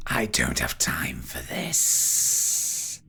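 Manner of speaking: low, slow whispery menace; impatient ghostly villain. Acted. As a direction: dismiss them in a slow, low hiss. Game master voices Derethor.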